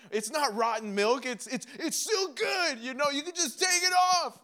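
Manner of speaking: rising into high, frantic insistence; escalating denial. Acted. Person in denial over rotten milk, escalating insistence. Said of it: "you s-, first deny it".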